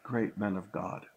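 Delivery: ordinary delivery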